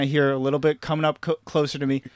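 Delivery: ordinary talk, nothing marked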